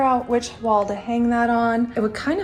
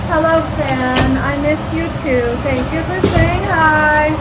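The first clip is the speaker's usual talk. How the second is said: slow, loud, sing-song greeting to a fan; playful pretend chat with the fan; mock sweet to the fan; 3 semitones higher, 6 dB louder than usual. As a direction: greet the fan like a pet, over its noise.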